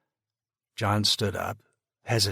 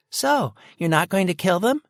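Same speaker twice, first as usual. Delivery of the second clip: put-on high, loud child's question; a kid breaking the tension; little girl voice; 10 semitones higher, 10 dB louder than usual. Little sister asks, voiced child.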